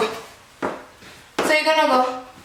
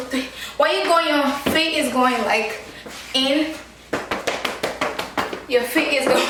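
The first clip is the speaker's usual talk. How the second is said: ordinary delivery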